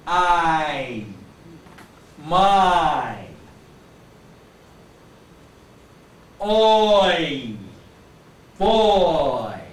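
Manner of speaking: put-on I, my, oi, boy stretched out loudly; moving diphthongs. Shown as a demonstration. Teacher showing diphthongs, drawn out with moving mouth. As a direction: glide slowly through each vowel in I, my, oi, boy. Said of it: "my mouth moves when I produce these diphthongs".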